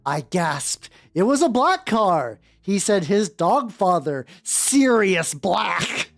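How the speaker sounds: ordinary talk, nothing marked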